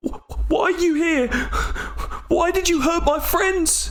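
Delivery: scared man demanding why they hurt his friends; distraught stranger. Acted. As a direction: ask why through fear and grief. Stranger confronts party.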